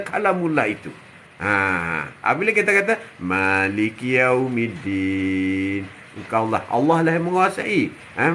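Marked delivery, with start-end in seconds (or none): 3.2-5.8 drawn-out, low chanted recitation; slow Quranic recitation. Acted. Preacher reciting to the congregation, slow chant. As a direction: intone the verse slowly and reverently.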